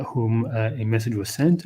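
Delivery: ordinary talk, nothing marked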